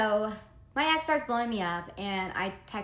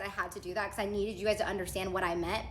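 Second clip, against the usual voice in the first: ordinary talk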